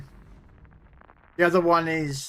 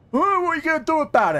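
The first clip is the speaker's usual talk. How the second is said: high, fast cocky taunt; drunk challenge; 13 semitones higher, 4 dB louder, faster than usual. Retold or imitated. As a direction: square up like a mouthy drunk. Drunk punter challenges bouncer.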